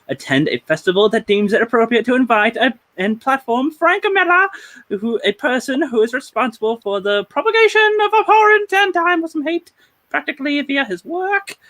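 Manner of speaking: high-pitched hammed-up British accent. Host quoting the cartoonist's protest, mock British voice, high. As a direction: read it in a put-on, high British voice. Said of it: "That is the British voice of the night".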